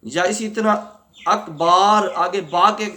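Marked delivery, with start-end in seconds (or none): 1.2-2.1 اکبار with a long aa after the b; stretched ba. Shown as a demonstration. Teacher showing the mistake of lengthening ba in اکبر. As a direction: say اکبار, adding an alif after ba. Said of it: "با کو کھینچا".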